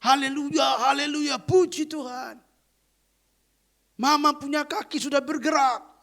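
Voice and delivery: high, joyful cry of a daughter; excited shout. Retold or imitated. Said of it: "anaknya berteriak".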